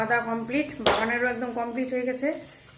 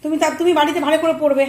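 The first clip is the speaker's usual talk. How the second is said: loud coaxing; 7 semitones higher, 12 dB louder, much faster than usual. Real, not acted. Mother telling the child to study at home, loud coaxing. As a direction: rattle it off high and loud.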